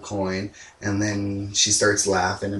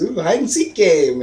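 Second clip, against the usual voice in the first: high, louder sing-song glee; gleeful leprechaun; 8 semitones higher, 5 dB louder than usual. Retold or imitated. Leprechaun taunts the escaping pair, high gleeful sing-song. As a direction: play it as a delighted little trickster. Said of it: "laughs about it and says".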